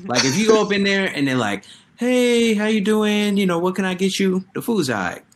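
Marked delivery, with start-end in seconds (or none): 2.0-4.4 chirpy, higher customer-service greeting; friendly counter lady. Retold or imitated. Retelling the cheerful woman at the Jamaican counter, higher friendly greeting. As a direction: welcome the customer brightly.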